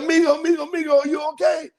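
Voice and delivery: high-pitched, urgent calling imitation; concerned bystander. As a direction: act out a worried local calling over. Bystanders checking on fallen rider.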